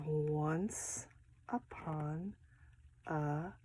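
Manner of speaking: soft, hushed Once upon a time; hushed magic words. Shown as a demonstration. Teacher to class, softly reading the magic words. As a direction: breathe it like a secret spell. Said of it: "there are magic words on this page".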